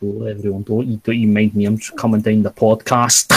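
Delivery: put-on Scottish mumble; mock Scottish accent. Podcaster coming down the podcast, mock Scottish accent. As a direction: imitate how everyone in Scotland speaks. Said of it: "So everyone in Scotland speaks".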